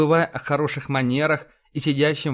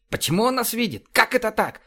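high, agitated questioning; 10 semitones higher than usual. A narrator's reading. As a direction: demand answers in high-pitched disbelief. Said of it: "От возбуждения он даже ругаться перестал". (взволнованно, на высоких нотах)

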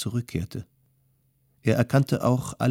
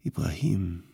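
subdued, sorrowful call of a name; sad, overwhelmed; 3 semitones lower, 4 dB quieter than usual. A narrator's reading. Man sees his friend has lost his mind, sad. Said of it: "traurig, vom Unglück überwältigt".

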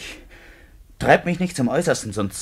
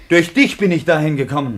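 angry; 4 semitones higher, 7 dB louder than usual. Acted. Quarrel, blaming her.

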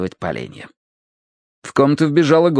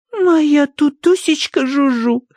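high, slow lisping coo; cutesy baby talk; much slower than usual. A narrator's reading. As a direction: coo over a pet dog. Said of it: "сюсюкала Тосси".

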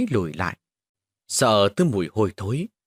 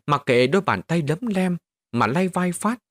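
ordinary delivery, nothing marked

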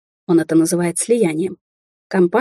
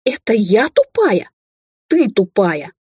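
outraged retort; 3 semitones higher, much slower than usual. A narrator's reading. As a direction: throw the insult back at him. Outraged quarrel reply.

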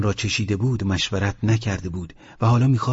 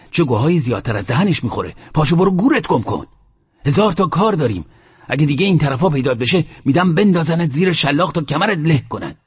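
heated threat in a higher, raised voice; retold fiery shouting; 5 semitones higher, 3 dB louder than usual. The sheikh threatens Mohammad with the whip, fiery shout. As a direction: imitate a furious sheikh throwing someone out. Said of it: "آتشی شد و داد زد".

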